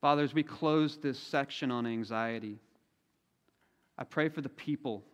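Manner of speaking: unhurried prayer with long pauses; leading prayer. Real, not acted. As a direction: pray aloud for the congregation, phrase by phrase. Leads the congregation in prayer.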